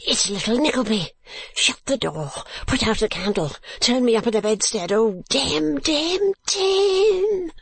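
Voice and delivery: high, strangled panic climbing to a squeal; suffocating voice. A narrator's reading. Mantalini spotting Nickleby from under bedclothes, suffocating cries. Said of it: "he cried in a suffocating voice".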